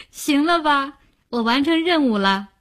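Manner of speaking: amused, breezy wrap-up; said laughing. A narrator's reading. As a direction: laugh it off, job done. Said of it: "摊开两手对吴晓笑道".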